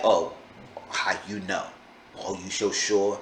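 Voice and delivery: low, quiet mock of his condescending dodge; mocking flippant tone. Narrator mimicking the catfisher's flippant, condescending replies. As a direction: imitate him brushing her off. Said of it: "being very rude and condescending and being flippant".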